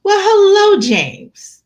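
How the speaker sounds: high, loud sing-out hello dropping on the name; mock-gushing doorstep welcome; teasing mom greeting. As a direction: greet the visitor with exaggerated delight. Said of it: "she answers the door and she goes".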